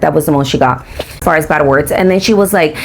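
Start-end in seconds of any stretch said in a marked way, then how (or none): none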